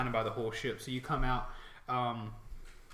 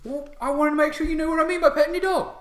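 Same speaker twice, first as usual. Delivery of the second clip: high, very loud orc squabble; bickering orcs; 12 semitones higher, 14 dB louder, faster than usual. Acted. Two orc guards quarrelling over petting the dog, bickering. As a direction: argue at full volume as exasperated orcs.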